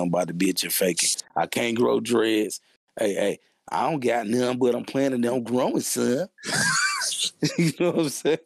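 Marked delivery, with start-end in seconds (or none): 6.3-7.5 high squealing laugh. Real, not acted. Laughing at own dreads joke.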